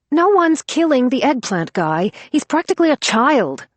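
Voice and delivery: high, loud objection; alarmed protest. Acted. Tied-up narrator objecting to the women, high and loud. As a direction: stop them cold, aghast.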